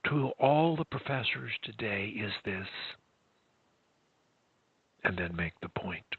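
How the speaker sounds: soft, low, intimate delivery; hushed voice. Shown as a demonstration. Coach modelling a speech to professors, hushed. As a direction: keep it down so they lean in. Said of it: "even in a whisper".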